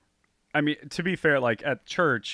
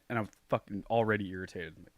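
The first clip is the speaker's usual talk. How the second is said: usual speaking voice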